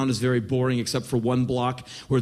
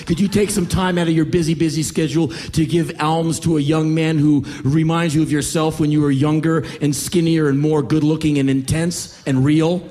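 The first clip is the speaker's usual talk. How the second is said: raised, relentless harangue; mocking tirade; 4 dB louder than usual. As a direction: pile up the taunts without a break. Young panhandler's tirade mocking the comedian.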